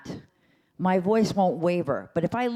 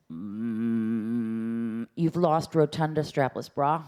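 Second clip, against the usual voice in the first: airless slow voice; 7 semitones lower, much slower than usual. Shown as a demonstration. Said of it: "You have no air".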